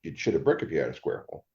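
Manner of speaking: low, soft, fast quote of the dad's saying. Retold or imitated. Quoting his dad's old saying, low and quick. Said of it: "Not only did he say".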